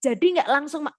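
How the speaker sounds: ordinary talk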